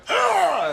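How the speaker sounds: high, loud laughing gag; mock retching yell. Patient vomiting charcoal, high loud retching cry.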